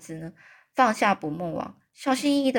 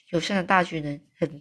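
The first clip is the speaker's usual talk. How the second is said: plain reading voice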